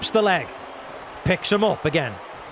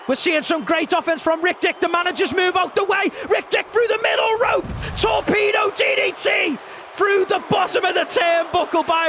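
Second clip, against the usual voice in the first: wrestling commentator; 6 semitones higher than usual. Acted. Live play-by-play.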